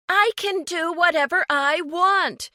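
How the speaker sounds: high, loud defiant shout; shouted back. A narrator's reading. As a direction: yell it back at him. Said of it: "Kinsley shouted back".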